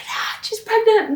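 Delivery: high, slow gasp of shock. Real, not acted. Learning Ginny is pregnant, high slow shocked cry. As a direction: react like terrible news.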